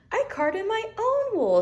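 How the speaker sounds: high, gleeful lamb voice; high excited Wilbur; delighted little lamb. Acted. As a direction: beam with pride, way up high. Wilbur proud.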